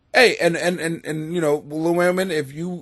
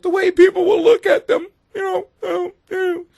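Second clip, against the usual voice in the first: high, lightly mocking woman's voice; a hint of mock woman. Imitating women worried about looks, light mock whine.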